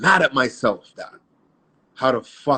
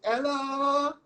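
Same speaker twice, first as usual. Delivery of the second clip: mom answering phone; 12 semitones higher than usual. Retold or imitated. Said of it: "I hear her in the kitchen".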